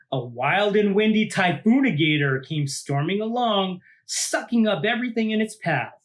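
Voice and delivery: put-on lively read-aloud for children; story narration. A narrator's reading. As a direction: tell it like a picture book to a class. Reads picture book aloud.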